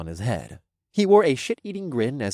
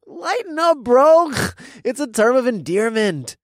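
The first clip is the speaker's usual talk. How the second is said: frat bro voice; 11 semitones higher, 5 dB louder than usual. A narrator's reading. Toby voice.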